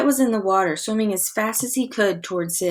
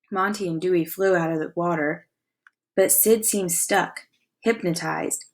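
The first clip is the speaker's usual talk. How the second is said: plain reading voice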